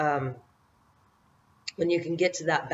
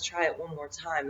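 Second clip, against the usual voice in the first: quiet inner voice; 7 dB quieter than usual. Retold or imitated. Inner voice urging her on.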